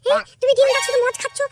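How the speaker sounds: high female voice, scolding; nagging wife. Acted. Wife nags her drinking husband to earn money, high and scolding. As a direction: push him to go to work.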